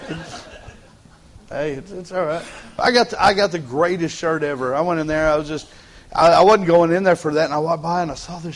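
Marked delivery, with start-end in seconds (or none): none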